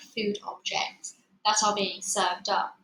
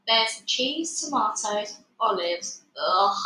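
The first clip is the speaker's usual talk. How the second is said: usual speaking voice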